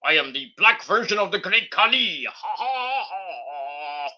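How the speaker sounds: a light parody of a giant wrestler's voice; mock Omos. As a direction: ham up a wrestler's bragging promo. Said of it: "He's just like".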